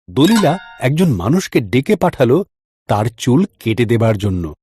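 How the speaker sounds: plain reading voice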